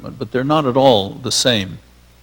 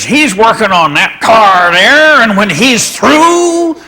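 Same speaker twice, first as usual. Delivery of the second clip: loud, high and very slow, stretching every word; Rexroth's way of talking; 8 semitones higher, 8 dB louder, slower than usual. Retold or imitated. Poet describing the car being fixed, slow booming drawl. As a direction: draw it out grandly like a rapt bard. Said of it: "he talked very much like this".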